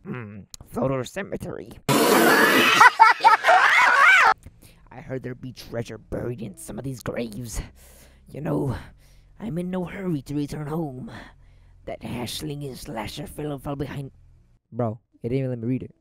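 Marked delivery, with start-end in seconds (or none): 0.1-1.7 lightly voiced crusty sea captain; a hint of a gruff old crab; Mister Krabs voice. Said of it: "my best Mister Krabs impression". 1.8-4.8 loud high wheezing cackle; Mister Krabs laugh. Retold or imitated. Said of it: "my best Mister Krabs impression". 4.9-14.1 lightly voiced crusty sea captain; a hint of a gruff old crab; Mister Krabs voice. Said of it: "my best Mister Krabs impression".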